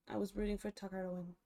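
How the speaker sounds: usual speaking voice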